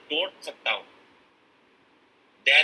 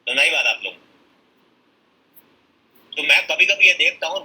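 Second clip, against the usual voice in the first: ordinary talk, nothing marked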